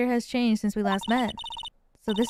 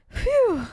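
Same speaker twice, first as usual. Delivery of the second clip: relieved sigh; 12 semitones higher, 4 dB louder than usual. Acted. Relief after apology.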